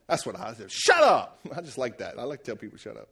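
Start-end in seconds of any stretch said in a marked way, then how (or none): 0.7-1.4 loud, high comic outburst; shouted retort. Acted. Preacher replays David silencing Goliath, shouting. 2.8-3.1 very low, hushed throwaway; quiet joking aside. Pretend. Preacher admits he likes telling people this, hushed.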